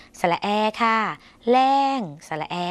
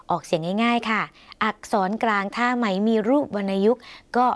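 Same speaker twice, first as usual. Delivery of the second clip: normal talking voice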